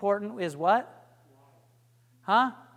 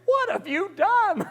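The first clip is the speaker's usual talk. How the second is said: shocked; 12 semitones higher, 4 dB louder than usual. Retold or imitated. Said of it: "Nancy's like".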